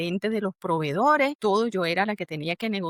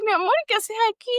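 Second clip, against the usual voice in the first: surprised greeting; 11 semitones higher, 4 dB louder, faster than usual. Retold or imitated. Acquaintance surprised to see her.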